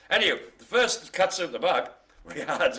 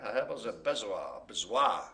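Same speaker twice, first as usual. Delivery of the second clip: lightly Frenchified, lower and easy; mock French accent; 5 semitones lower, 5 dB quieter than usual. Host showing off to seem sophisticated, French accent. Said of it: "everything in a French accent".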